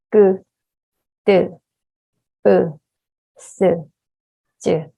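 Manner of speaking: each consonant spaced out and distinct; slow and clear. Shown as a demonstration. Teacher reading consonants aloud for students, slow and clear. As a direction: read slowly, one by one, clearly. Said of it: "let's read the consonants slowly but clearly".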